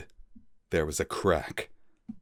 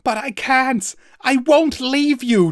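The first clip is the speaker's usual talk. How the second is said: much higher, louder, slow plea; desperate cry; 7 dB louder than usual. Acted. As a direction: cry out, refusing to leave. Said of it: "Twig cried out".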